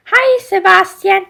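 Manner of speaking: high, loud cheerful hello; Ariel greeting. Acted. Ariel doll greeting Sebastian, high and cheerful. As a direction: greet the crab friend brightly.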